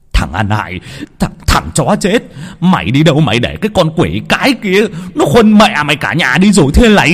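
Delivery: high, loud curses in a torrent; furious cursing. Acted. Father cursing his useless son to his face, furious tirade. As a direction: pour abuse in his face. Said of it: "chửi xa xả vào mặt nó".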